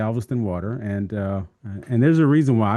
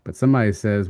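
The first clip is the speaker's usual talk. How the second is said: ordinary delivery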